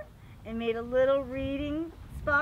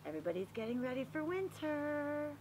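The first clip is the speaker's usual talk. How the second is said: plain reading voice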